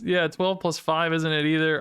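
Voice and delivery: ordinary talk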